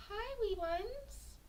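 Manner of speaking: high, soft, slow greeting; cooing baby talk. Acted. Streamer greeting her desktop squirrels, high soft coo. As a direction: coo gently as if to tiny pets.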